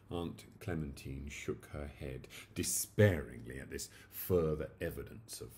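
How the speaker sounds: ordinary delivery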